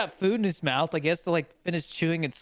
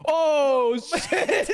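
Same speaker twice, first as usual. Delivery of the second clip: high, laughing exclamation; laughing shock; 8 semitones higher, 4 dB louder than usual. Retold or imitated. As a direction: burst out laughing mid-curse. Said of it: "And the camera goes like".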